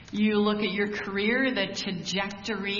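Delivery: ordinary delivery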